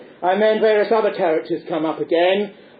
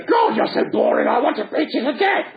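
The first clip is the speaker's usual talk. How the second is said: higher, hammed-up puppet outburst; exasperated Punch; 7 semitones higher than usual. Punch is bored by irritating Joey, mock exasperated outburst. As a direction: burst out in mock fury.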